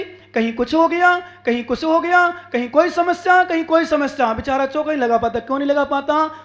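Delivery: usual speaking voice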